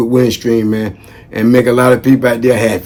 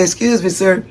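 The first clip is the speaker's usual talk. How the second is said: calling out; 6 semitones higher, 4 dB quieter than usual. Retold or imitated. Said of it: "I say".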